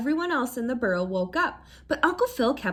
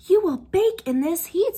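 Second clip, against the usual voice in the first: fussy aunt; 7 semitones higher, 5 dB louder than usual. A narrator's reading. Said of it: "said Aunt Patsy".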